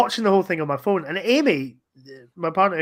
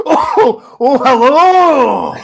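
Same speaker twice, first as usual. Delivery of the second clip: high, loud, drawn-out Scottish "oh, hello"; mock Barrowman hello; 8 semitones higher, 10 dB louder, much slower than usual. Joining the riff as Barrowman, a camp drawn-out hello. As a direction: camp over-the-top greeting. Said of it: "It's just like".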